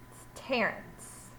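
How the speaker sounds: hushed, slow whisper of the name; whispered title. Acted. Teacher to class, whispering the story's title. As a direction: breathe the title out softly.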